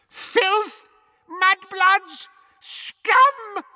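very high, screeched insults; screaming. A narrator's reading. Portrait of Sirius's mother screaming after intruders in the hall, screeching. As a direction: scream each word separately as abuse. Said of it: "she screamed after them".

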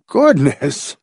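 flustered little outburst; gasped. A narrator's reading. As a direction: gasp it out like a startled old man. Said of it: "Doctor Tillingford gasped".

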